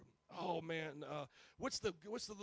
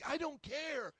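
dismissive retort; 8 semitones higher, 4 dB louder, slower than usual. Retold or imitated. Retold quarrel, dismissive reply.

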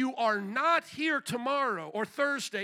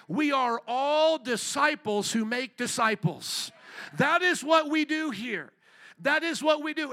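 proclaiming loudly; 4 semitones higher, 5 dB louder than usual. Real, not acted. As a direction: declare it like a rallying cry. Preacher proclaims church motto loudly.

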